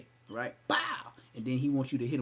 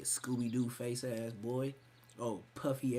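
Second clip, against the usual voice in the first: ordinary talk, nothing marked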